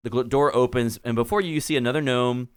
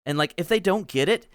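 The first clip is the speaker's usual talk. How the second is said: high breezy gnome, constant "like"; valley girl gnome mayor; 6 semitones higher than usual. Acted. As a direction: tell it casually, like gossip. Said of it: "Bilby Bourbon Durbin says".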